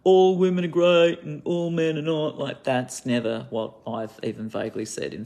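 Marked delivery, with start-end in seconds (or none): 0.0-2.4 mocking quote. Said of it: "thinks that I'm like".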